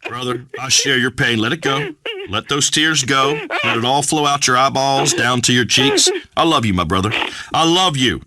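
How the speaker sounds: usual speaking voice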